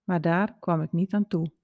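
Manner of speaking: ordinary delivery, nothing marked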